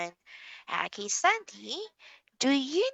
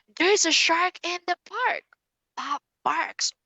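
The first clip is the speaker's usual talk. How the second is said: put-on lively, higher storybook reading for a young learner; reading to a child; 5 semitones higher than usual. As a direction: bring the shark story alive for Sandy.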